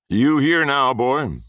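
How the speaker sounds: high, loud mocking old man's greeting; smirking grandfather. A narrator's reading. Grandfather smirking at the frightened boy, high and loud. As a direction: sneer it down at the boy. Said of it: "said my grandfather, smirking at me".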